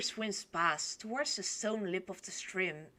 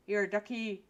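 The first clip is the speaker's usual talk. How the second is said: usual speaking voice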